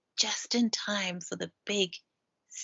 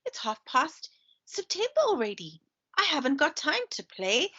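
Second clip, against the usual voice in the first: higher, fussy hedgehog voice; 6 semitones higher than usual. A narrator's reading. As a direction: read it as a busy, fussing hedgehog. Hatty's voice in read-aloud story.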